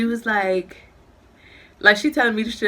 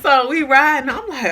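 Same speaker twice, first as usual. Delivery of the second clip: ordinary talk, nothing marked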